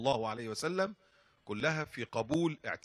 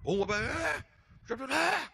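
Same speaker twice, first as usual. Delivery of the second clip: ranting driver; 11 semitones higher than usual. Retold or imitated.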